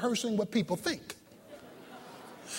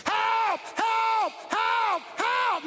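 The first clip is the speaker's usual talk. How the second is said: high, drawn-out mock cry for help; 10 semitones higher, much slower than usual. Preacher acting out passengers stuck in an elevator, crying out. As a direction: imitate trapped people hollering for help. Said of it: "Some people were crying".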